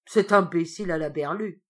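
loud scornful sneer. Acted. Mescam sneering at the frightened boy. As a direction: spit it out with a mocking laugh in the voice. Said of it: "Mescam ricana".

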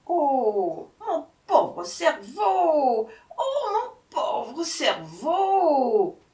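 high, slow, drawn-out lament; wailing jackal. A narrator's reading. Jackal lamenting his poor brain, wailing. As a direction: wail it out with long swooping vowels. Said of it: "s'écria le chacal en se tordant les pattes".

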